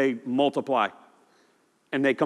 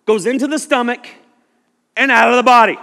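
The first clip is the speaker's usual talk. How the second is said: higher, louder, half-shouted verse; loud scripture; 8 semitones higher, 8 dB louder than usual. Retold or imitated. Preacher reading Jesus' words to Peter loudly. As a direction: preach it out with force. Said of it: "Verse seventeen".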